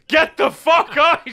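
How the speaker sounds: high, loud, drawn-out yell; outraged mock shout. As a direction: imitate scandalised people yelling you out. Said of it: "people were like".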